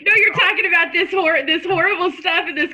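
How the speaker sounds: can't stop laughing at the cat; laughing while talking. Real, not acted. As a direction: torn between tears and laughter, real laugh in the voice. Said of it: "sitting here laughing at Colleen's cat".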